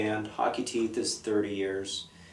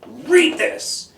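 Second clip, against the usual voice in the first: much higher, loud challenging bark; confrontational; 8 dB louder than usual. Retold or imitated. Teen handing the teacher a book, confrontational and loud. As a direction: throw it at her like a dare. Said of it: "In a very confrontational voice".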